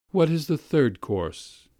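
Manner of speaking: tired, aggrieved lady of the house; weary, injured. A narrator's reading. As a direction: ask like a wounded hostess, slowly. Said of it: "in a weary, injured voice".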